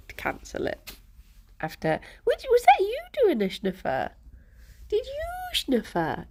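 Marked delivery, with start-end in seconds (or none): 2.2-6.3 pet talk. Real, not acted. Cooing at her pet cat.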